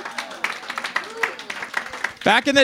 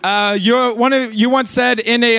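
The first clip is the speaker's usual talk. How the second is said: normal talking voice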